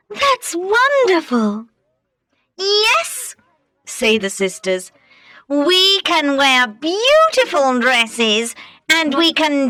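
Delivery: high, slow, gushing ugly sister voice; the sisters swooning over the ball, pitched high. Acted. Ugly sisters dreaming of the prince, high and gushing.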